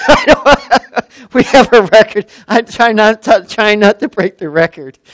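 loud, high, laughing through the words; real laughing. Real, not acted. Laughing about running over time.

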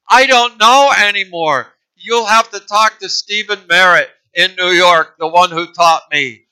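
exasperated. Acted. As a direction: give up, exasperated. Throws her hands in the air.

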